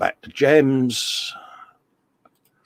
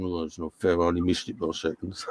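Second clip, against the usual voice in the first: imitating a sulky Fred, much softer, mumbled and fast; sulking Fred; 6 semitones lower, 10 dB quieter, faster than usual. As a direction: moan it like a sore loser kicking at the floor. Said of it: "Fred stubbing his feet round his shed going".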